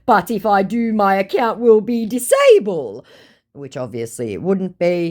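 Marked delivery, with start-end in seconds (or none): 0.0-3.0 higher, louder excuse-making voice; lightly voiced scammer. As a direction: make flimsy excuses. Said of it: "he goes".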